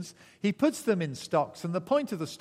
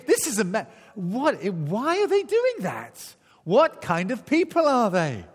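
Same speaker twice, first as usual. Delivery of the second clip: amazed; 7 semitones higher, 7 dB louder than usual. Retold or imitated. Prisoners wondering at worship.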